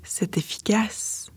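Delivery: fairy godmother quietly vouching for her remedy; hushed fairy. Acted. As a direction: murmur it softly at the bedside.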